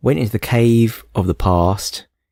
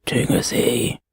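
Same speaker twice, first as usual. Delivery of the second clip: put-on quiet breathy old man whisper; 3 semitones higher, 5 dB quieter, slower than usual. Shown as a demonstration. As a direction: croak it like a frail old man. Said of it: "And now he'll say it in some old man voice".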